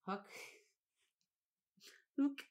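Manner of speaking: high, giggling repeat of the name; laughing at a name. Real, not acted.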